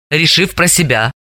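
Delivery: ordinary delivery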